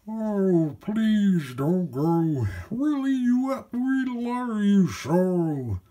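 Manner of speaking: very high, loud, slow wailing plea; wild things begging Max to stay, high slow loud wail; pleading monsters. A narrator's reading. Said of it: "the wild things cried".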